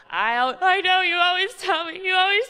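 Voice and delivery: put-on high, loud whining kid voice; whiny kid. Daughter cutting off mom's lecture, high whiny voice. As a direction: rattle it off like a guilty ten-year-old. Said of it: "before I can even go in with".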